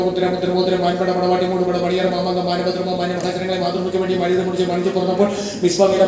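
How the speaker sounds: breathless list rattled off on one even pitch; rapid-fire patter recitation. Acted. Comic reels off M-words to the audience, rapid-fire patter. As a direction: reel it off without stopping, evenly pitched.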